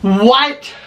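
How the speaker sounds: high, loud shocked What; stunned high cry of What. Retold or imitated. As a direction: blurt it out in disbelief. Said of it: "and I go".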